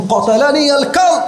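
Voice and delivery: high-pitched cry for help; acted cry. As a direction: cry out as the stabbed caliph. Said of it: "চিৎকার দিয়ে বলছেন". (চিৎকারের অভিনয়)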